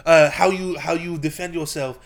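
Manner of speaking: mock Israeli instructor, slowing at the end; Israeli accent. Trainer quizzing on self-defense, mock Israeli accent. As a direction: quiz them in an imitated Israeli accent. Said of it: "he was playing the Israeli".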